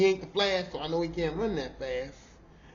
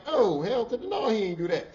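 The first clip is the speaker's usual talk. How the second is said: sassy denial; 6 semitones higher, 4 dB louder than usual. Retold or imitated. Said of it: "I was trying to defend him".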